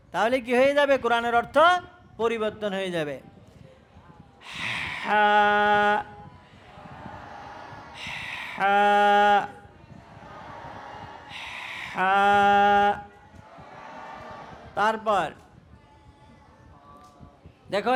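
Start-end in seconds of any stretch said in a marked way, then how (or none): 5.0-12.9 হা pushed from mid throat with air first, lower; breathy throat ha. Shown as a demonstration. Teacher models a breathy throat হা for students. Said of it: "আমার মতো আওয়াজও বাইর করতে হবে".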